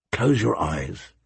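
low, soft and slow; gentle. Acted. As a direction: soothe her toward sleep. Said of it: "he said gently".

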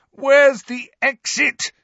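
high, loud, drawn-out cry; urgent, high-pitched yell; desperate shout. A narrator's reading. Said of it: "he shouted desperately".

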